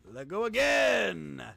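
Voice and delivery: loud, slow shout. Real, not acted. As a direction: bellow the command. Repeated shouted command.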